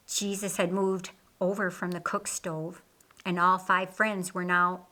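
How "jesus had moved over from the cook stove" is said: usual speaking voice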